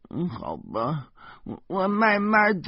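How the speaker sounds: put-on a small girl agreeing reluctantly; little girl voice. A narrator's reading. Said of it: "小魔女说".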